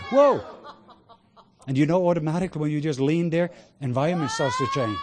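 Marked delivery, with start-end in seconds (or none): 0.1-0.4 short "whoa" much higher and louder; a burst of awe; sudden rapturous cry; ecstatic whoa. Real, not acted. Overwhelmed by presence.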